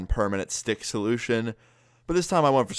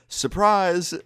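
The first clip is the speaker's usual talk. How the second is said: surprise shout; 9 semitones higher, 4 dB louder than usual. Retold or imitated. Parents wake son with surprise.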